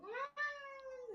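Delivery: high drawn-out kitten meow. Retold or imitated. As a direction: mew like a tiny kitten. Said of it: "they're just like".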